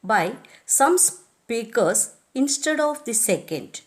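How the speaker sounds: ordinary talk, nothing marked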